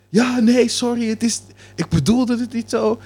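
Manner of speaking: high, hammed-up sorry voice; a caricatured apologizing neighbour; imitating apologetic man. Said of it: "die kerel bleef de excuses maar aanbieden".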